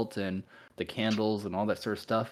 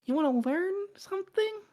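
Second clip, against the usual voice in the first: seductive succubus; 7 dB louder than usual. Acted. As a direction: coo it high, like a temptress. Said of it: "And she goes".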